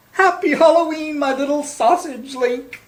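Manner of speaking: high, bright-toned loving mom voice; doting mother. Acted. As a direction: coo it at her son. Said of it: "said Oscar's mother".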